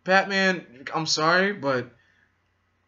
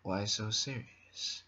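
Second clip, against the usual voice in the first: low, soft, slow whispered Joker; menacing hushed villain monologue; imitating the Joker telling his scar story; Joker impression; 5 semitones lower, 11 dB quieter, slower than usual. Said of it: "the voice".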